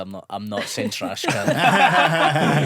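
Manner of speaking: slow, loud, laughing through the words; laughing refusal. Retold or imitated. Comic telling producers he won't say it, laughing. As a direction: refuse it while cracking up. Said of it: "and I said".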